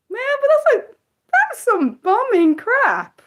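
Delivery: high-pitched drawn-out complaint; exasperated. Real, not acted. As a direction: whine in protest, stretching the words. Told show is unavailable there.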